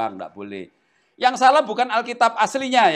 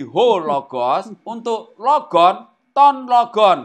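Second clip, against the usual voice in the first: slow demo reading; 5 dB louder, much slower than usual. Shown as a demonstration. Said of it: "reading Greek forms aloud".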